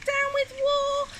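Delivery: slow, very high-pitched hoot. A narrator's reading. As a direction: stretch the slogan into a high hoot. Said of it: "and he hooted".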